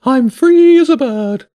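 high, loud and slow bird voice; a giddy pigeon savouring freedom, high and loud; high bird voice drawn out with joy; joyful pigeon. Acted.

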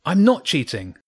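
nearly shouting in protest; almost shouting. A narrator's reading. Boy protesting to his teacher, almost shouting. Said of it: "James was almost shouting".

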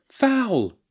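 high-pitched protesting shout; sharp, high cry from the pitch. A narrator's reading. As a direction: shout an appeal to the referee. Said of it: "Jenny heard Nancy shouting".

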